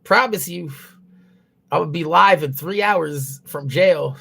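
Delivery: ordinary talk, nothing marked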